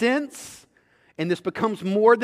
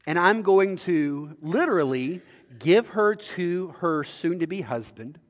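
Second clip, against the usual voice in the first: ordinary talk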